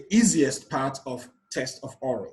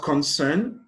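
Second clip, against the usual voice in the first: concern said slowly as con-CERN; stress on second syllable; 3 dB louder than usual. Shown as a demonstration. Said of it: "placed on the second syllable".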